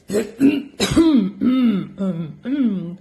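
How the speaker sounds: wolf clears his throat; a rough throat clear before speaking. Acted. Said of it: "asked in a voice as friendly as he could".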